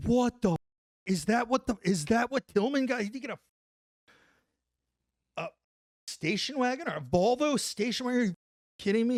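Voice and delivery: high, loud cry of disbelief; shocked disbelief. Retold or imitated. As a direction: yell it like you can't believe the car. Soldiers shocked at the Volvo.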